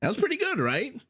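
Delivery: squeaky high, delighted; fishing for praise, thrilled; proud. Real, not acted. Pleased after nailing phrase.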